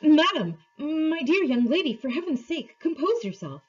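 high-pitched, agitated pleading; a mock tremulous scream, pitched well up; trembling scream. A narrator's reading. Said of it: "in a sort of trembling".

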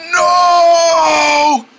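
strained, cracking no; frustrated cry. Acted. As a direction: wail it out in frustration. Voice still gone, frustrated.

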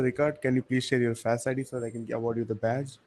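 usual speaking voice

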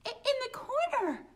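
high, loud scared voice; scared girl pointing; frightened stammer. Acted. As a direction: stumble over the words in fear. Olive frightened.

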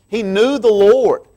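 normal talking voice

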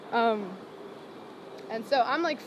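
usual speaking voice

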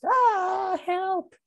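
very high drawn-out cry for help; panicked shout. A narrator's reading. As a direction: wail it high like a scared little character. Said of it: "he probably shouts".

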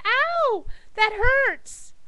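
high-pitched mock ow; hammed-up cry of pain; pained yelp. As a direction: wail it high, playing hurt. Joke patient touches sore spot.

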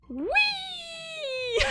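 very high long whee into laugh; gleeful whee. Real, not acted. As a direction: ride it like a slide. Sliding down in game.

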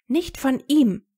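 laut, höher und langsam, gepresst vor Zorn; noch wütender. A narrator's reading. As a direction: jedes Wort hart setzen. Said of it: "hörte sich noch wütender an". (Hermine lehnt Snapes Hilfe zornig ab)

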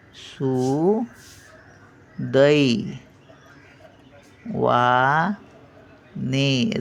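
crawl through सुदैवाने, with no र; very slow demo. Shown as a demonstration. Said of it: "रफार येणारच नाही". (सुदैवाने अगदी सावकाश, हळू उच्चार)

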